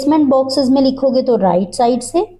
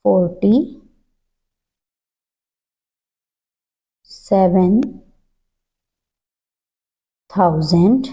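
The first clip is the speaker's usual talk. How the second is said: slow dictation; much slower than usual. Shown as a demonstration. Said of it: "teacher dictates slowly, word by word".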